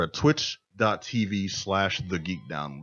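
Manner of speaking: slowed-down, measured web address. Real, not acted. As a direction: spell out the channel address slowly. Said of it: "I'm gonna talk slower".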